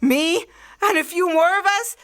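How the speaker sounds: building from eager to a high, triumphant cry; grandiose excitement. Acted. Artilleryman tells the stranger they would own the world, building to triumph. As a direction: crown yourself king of the world. Megalomaniac climax of his plan.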